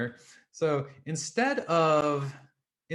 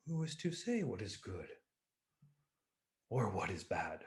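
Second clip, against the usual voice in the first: softer, sage-like calm reply; serene old farmer; 4 semitones lower, 10 dB quieter than usual. Acted. Old farmer answers the ecstatic villagers, soft and serene.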